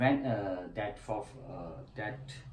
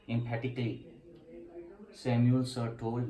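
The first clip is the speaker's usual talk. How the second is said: ordinary talk, nothing marked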